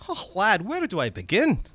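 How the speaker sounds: high, excited Scottish goblin; wheedling little red cap creature voice. Acted. Goblin speaks in story.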